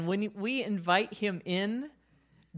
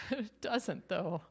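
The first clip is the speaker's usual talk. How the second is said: normal talking voice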